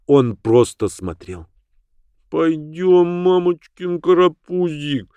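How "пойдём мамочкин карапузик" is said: put-on higher cooing baby-talk. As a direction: coo at the dog like a baby. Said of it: "засюсюкала".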